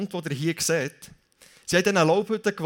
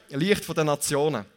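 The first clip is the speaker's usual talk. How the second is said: usual speaking voice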